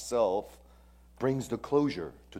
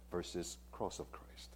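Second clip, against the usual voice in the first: hushed; 5 semitones lower, 15 dB quieter than usual. Real, not acted. Reverent contrast repeated.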